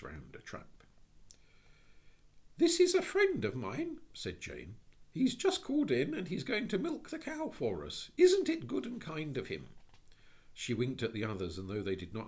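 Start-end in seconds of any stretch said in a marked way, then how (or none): 2.6-4.0 put-on high, light young girl; little girl voice. 5.1-9.7 put-on high, light young girl; little girl voice.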